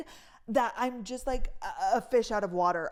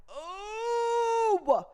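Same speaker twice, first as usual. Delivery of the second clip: a high, drawn-out oh of sudden remembering; high cry of realization; 13 semitones higher, 4 dB louder than usual. Real, not acted. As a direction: it just hit you, let the oh fly up high. Sudden memory of embarrassing moment.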